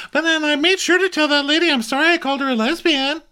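high mock Brandy voice; Brandy impression. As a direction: squeak it out like a flustered Brandy.

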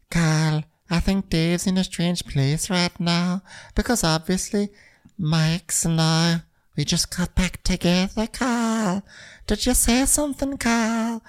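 sing-song it like a dreamy flirt; ditzy Natasha. Retold or imitated. Natasha telling Kyle about her love life, in a high mock sing-song. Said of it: "she's like".

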